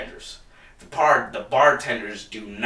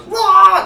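a sudden roar, much higher and louder; imitated crowd roar; 12 dB louder than usual. Mimicking the whole crowd roaring at a joke. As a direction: let out a huge crowd-style roar. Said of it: "the whole fucking crowd goes".

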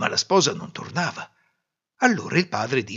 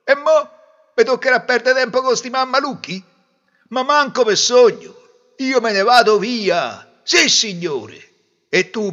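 loud, raised, indignant tirade; angry yelling; 9 semitones higher, 8 dB louder than usual. Acted. Groom walking out on foolish in-laws, angry yelling. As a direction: tell them off loudly, fed up. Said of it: "si arrabbiò e cominciò a urlare".

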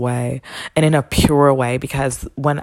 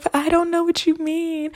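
high-pitched mock-tearful whine; exaggerated wounded protest; retold tearful protest. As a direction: plead as if close to tears, hammed up. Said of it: "I'm tearing up and shedding tears".

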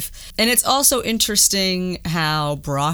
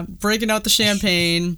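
usual speaking voice

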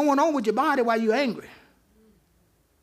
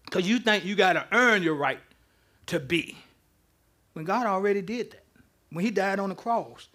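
normal talking voice

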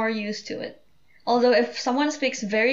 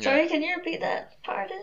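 mock confused listener; 6 semitones higher, 3 dB quieter than usual. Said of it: "a what after each sentence".

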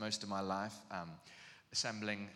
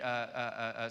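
usual speaking voice

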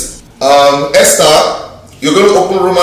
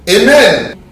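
ordinary talk

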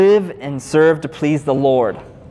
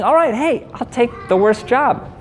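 1 eager volunteer; 8 semitones higher than usual. Retold or imitated. Retelling his own volunteering.

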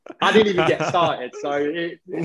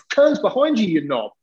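retold angry retort; 5 semitones higher than usual. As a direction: snap it back like an irritated teammate. Wardy's retold angry reply.

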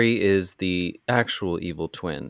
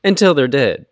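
emphatic rant; 9 semitones higher, 9 dB louder than usual. Real, not acted. Ranting about the film.

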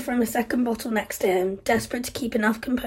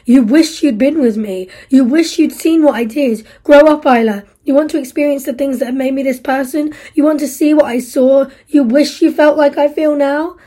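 higher, louder mocking rhetorical questions; scornful fury; 4 semitones higher, 9 dB louder than usual. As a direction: throw her words back at her. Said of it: "The rhetorical questions kept on coming".

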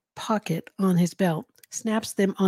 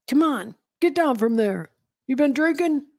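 higher-pitched, demanding police officer; gruff New York cop; 7 semitones higher, 4 dB louder than usual. A narrator's reading. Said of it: "gruff voice".

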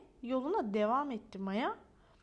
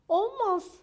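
high, loud no; dramatic refusal; 8 semitones higher, 7 dB louder than usual. Acted. Maya turns down Rex's invitation, high dramatic refusal.

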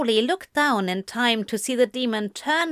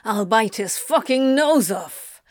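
angry character-voiced threat; snapping. A narrator's reading. Anthony threatening Gabriel on the angel's behalf, snapping. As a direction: snap the threat out. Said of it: "Anthony snapped".

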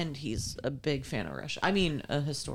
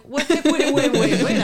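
mock panic; 9 semitones higher, 13 dB louder than usual. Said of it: "I was like".

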